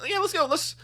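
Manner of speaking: sudden high eager burst; eager high yelp of agreement; excited. Real, not acted. Jumps at the idea of going.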